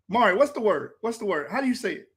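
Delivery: ordinary talk, nothing marked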